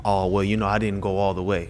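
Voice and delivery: low, soft mock excuse; downplaying excuse. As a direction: brush it off quietly, as if quoting an excuse. Voicing someone excusing their lapse.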